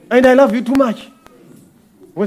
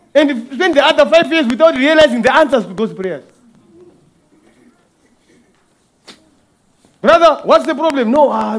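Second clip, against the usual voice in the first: fiery preaching; 3 semitones higher, 4 dB louder than usual. Acted. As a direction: preach it like calling witnesses to the stand. Preaching at full force.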